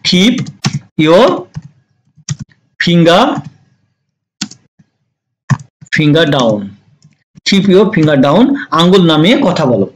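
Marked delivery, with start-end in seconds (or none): 0.1-6.6 long pauses between words; halting, spaced-out model phrase. Shown as a demonstration. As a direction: dictate it piece by piece for learners. Teacher dictates phrase word by word.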